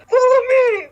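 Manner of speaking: high, loud commanding yell. Acted. Bellamy leading the group away from acid fog, yelling. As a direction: order everyone to follow. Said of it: "Bellamy yelled".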